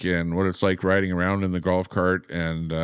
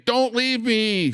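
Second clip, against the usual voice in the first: much higher, slow pleading woman's voice; slower than usual. Retold or imitated. Streamer voicing the woman in a cartoon, high and pleading. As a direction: beg him not to go in a high voice. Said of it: "The woman is saying".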